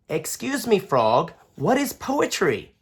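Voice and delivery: put-on bright curious kid asking; young boy Daniel. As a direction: ask like a curious little boy. Daniel child voice.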